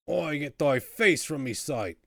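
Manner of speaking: indignant Cockney outburst; angry dismissal. Acted. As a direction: send them packing as an irate jailbird. Prisoner angrily dismisses the player.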